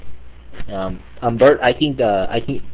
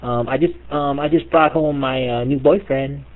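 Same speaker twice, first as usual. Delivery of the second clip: timid daughter; 5 semitones higher than usual. Retold or imitated.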